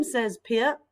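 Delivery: normal talking voice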